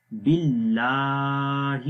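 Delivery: "باللہ" with a thin, light lam, slowly chanted; thin-lam recitation; thin Allah. Shown as a demonstration. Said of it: "اللہ کا نام باریک پڑھیں گے".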